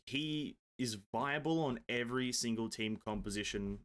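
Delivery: usual speaking voice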